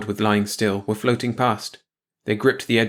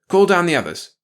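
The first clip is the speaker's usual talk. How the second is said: firm order; 8 semitones higher, 5 dB louder than usual. Acted. Captor giving orders.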